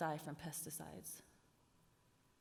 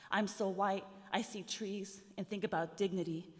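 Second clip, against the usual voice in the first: spoken word poem; 3 semitones higher, 6 dB louder than usual. Acted. Poet performing her poem to the audience, rising spoken word. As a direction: build the litany, punching the refrain.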